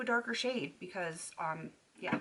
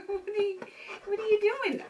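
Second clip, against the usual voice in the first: puppy talk; 13 semitones higher than usual. Real, not acted. Owner cooing at her chewing puppy in baby talk. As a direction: coo at the puppy.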